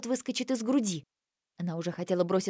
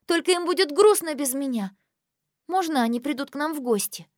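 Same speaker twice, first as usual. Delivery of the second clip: put-on pleading girl voice; 7 semitones higher, 4 dB louder than usual. Aurora pleads.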